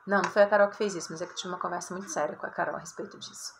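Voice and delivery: a quick joking explanation, tumbling out; rushed aside. Real, not acted. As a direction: rattle it off fast, dropping to a murmur. (apressado e baixinho)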